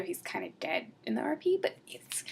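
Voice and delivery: soft joking whisper; whispered joke; whispered aside. Real, not acted. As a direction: slip the joke in under your breath.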